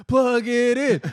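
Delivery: high, loud yell through a laugh; loud laughing heckle; laughing shout. Retold or imitated. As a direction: call out to the stage, cracking up. Heckling the comic.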